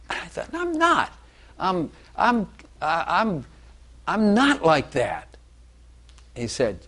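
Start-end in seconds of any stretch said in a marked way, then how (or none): 0.5-5.3 much higher, startled protest; shocked denial. Retold or imitated. Man recalling his denial to God's voice, shocked and high. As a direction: blurt the denial in alarm.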